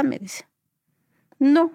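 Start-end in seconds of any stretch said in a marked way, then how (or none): 1.4-1.7 louder, higher blunt "no"; retold unfriendly brush-off; curt refusal. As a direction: refuse rudely, short and firm. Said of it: "le dije feo".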